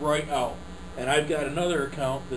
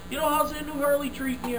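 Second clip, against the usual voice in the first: much higher, sugary small-talk voice; fake friendly; 10 semitones higher than usual. Salesman chatting up the gatekeeper, fake friendly. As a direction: beam a fake smile through it. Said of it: "And I'm like that".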